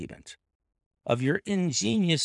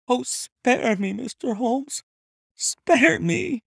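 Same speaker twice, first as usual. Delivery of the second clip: frantic pleading; 13 semitones higher than usual. A narrator's reading. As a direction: beg for mercy on your knees. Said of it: "she pleaded in a frenzy of supplication".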